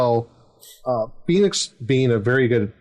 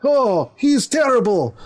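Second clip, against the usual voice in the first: much higher, squawking outraged father; father reacts to daughter's confession, much higher and outraged; indignant father; 14 semitones higher than usual. Retold or imitated. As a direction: play the father, shocked and scandalised. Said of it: "So I acted like the father".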